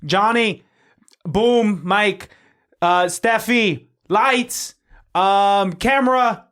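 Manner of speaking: loud, high set-wide call; director calling out. Acted. Director rallying the crew before a take, calling out. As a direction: project commands across a film set.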